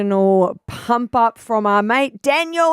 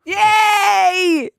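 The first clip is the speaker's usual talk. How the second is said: cheering shout; 9 semitones higher than usual. Real, not acted. Cheering after horn.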